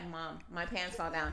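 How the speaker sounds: calm, no alarm at all; lightly voiced deadpan son; flat deadpan child. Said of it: "He's like".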